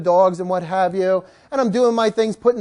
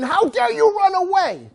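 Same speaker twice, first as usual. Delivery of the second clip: much higher, slow mock scold; mock yelling; 9 semitones higher than usual. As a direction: pretend to yell at the dogs, slowly. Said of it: "if I would have yelled".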